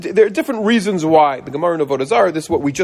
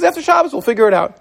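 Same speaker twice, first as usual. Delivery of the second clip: high, bright friendly store owner; generous shop owner; 7 semitones higher than usual. Retold or imitated. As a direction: play the kind shop owner. Store owner voiced in story.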